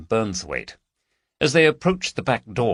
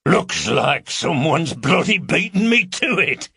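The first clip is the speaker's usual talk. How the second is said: deep amused man; 9 semitones higher, 4 dB louder than usual. Acted. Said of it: "a deep voice laughed".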